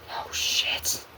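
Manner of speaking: frightened whisper. Acted. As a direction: whisper it in fear. Frightened reaction.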